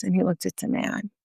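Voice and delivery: ordinary talk, nothing marked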